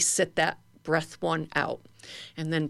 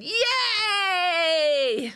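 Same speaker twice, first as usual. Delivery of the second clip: high joyful cheer; 12 semitones higher than usual. Shown as a demonstration. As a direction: cheer with arms up. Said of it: "hands above our head and go".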